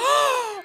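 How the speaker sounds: high, mock-horrified gasp; shocked gasp. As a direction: gasp as if witnessing a crime. Reenacts shock at witnessed exam.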